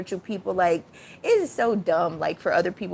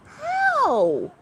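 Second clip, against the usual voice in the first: outraged how. Real, not acted. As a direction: demand an answer in disbelief. Outraged rhetorical question.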